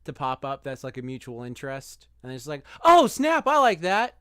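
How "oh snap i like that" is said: much higher and louder, an eager Oh snap; mock excited. Guy spotting a mutual interest, hammed-up excitement. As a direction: burst out like you found a shared favorite.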